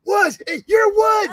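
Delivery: high, loud excited calling out; excited fans yelling his name; kids shouting. Retold or imitated. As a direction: yell across the lobby at a star. Crowd of kids spot him.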